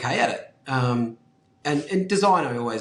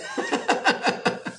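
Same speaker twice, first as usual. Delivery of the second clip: laugh. Real, not acted. Laughing after joke about kids.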